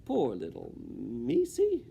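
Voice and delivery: high, slow pitying coo; Mig cooing. Acted. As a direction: coo over the poor mouse. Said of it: "she said".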